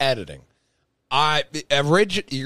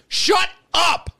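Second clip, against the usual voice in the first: high, loud mock tirade at himself; mock self-yelling; 4 dB louder than usual. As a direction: yell at yourself like a fed-up critic. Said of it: "I'm yelling at myself".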